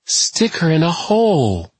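high, slow, off-kilter mockery; unstable, taunting woman. A narrator's reading. Tess mocking what others plan for her, high and taunting. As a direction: draw it out high and bitterly mocking. Disturbed patient mocks her own treatment.

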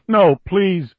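high frightened plea; pleading. Acted. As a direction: beg in terror. Billy begging under torture.

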